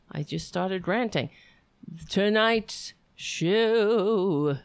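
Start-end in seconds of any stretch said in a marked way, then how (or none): 2.1-4.6 slow, drawn-out show announcer. Shown as a demonstration. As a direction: stretch "Tonight's show" like a host intro. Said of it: "I should have said this right off the bat".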